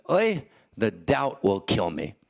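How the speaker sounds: slow, tragic Yiddish lament; Yiddish accent. Acted. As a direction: Yiddish accent, drawn out in despair. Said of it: "in a slight Yiddish accent".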